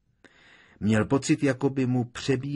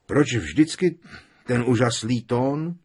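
loud, higher-pitched grumble; somewhat grumpy; 4 semitones higher, 6 dB louder than usual. A narrator's reading. Ron answering Hermiona's amazement, somewhat grumpy. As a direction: retort with a touch of grievance. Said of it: "opáčil poněkud nabručeně Ron".

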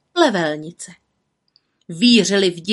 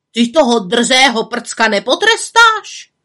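spiteful aunt; 4 semitones higher, 7 dB louder than usual. A narrator's reading. Aunt urging punishment.

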